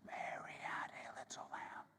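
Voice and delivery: soft unvoiced whisper on the out-breath; exhaled whisper. Shown as a demonstration. Teacher whispering on the exhale for students. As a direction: let the words ride out on the exhale.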